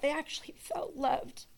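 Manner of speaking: wife honoring youth leaders, soft and sincere; soft sincere repeat. Real, not acted. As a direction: let it land quietly, meaning it. Repeats line for emphasis.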